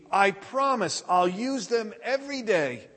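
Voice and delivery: put-on high eager teenager promising; pleading teen. Teen begs father for weights.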